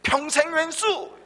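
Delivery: put-on high, slow, drawn-out old woman's cry; grandma's punchline. Grandma answering her husband on a TV game, high and slow. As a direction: stretch out the punchline like a cranky grandma.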